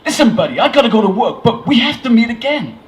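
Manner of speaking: loud, high, eager American; bad American accent. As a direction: urge him in a hammy American accent. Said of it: "a terrible American accent".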